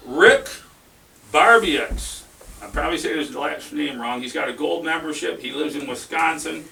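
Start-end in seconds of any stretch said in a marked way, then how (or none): 0.1-2.2 big announcer call. Real, not acted. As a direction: stretch the name out, much higher and louder. Announcing the seed winner.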